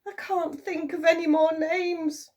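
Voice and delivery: high, loud despairing cry; a touch of despair; desperate. A narrator's reading. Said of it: "she cried".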